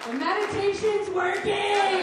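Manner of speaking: slow, loud and high, arms-up triumph; triumphant shout. Pretend. Telling the crowd her meditation works, triumphant shout. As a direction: declare victory at full volume.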